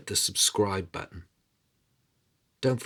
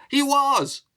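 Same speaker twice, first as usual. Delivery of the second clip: put-on much higher, louder shrill old woman voice; old queen mother; 7 dB louder than usual. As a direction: pipe up as the ancient mother. King's mother repeats.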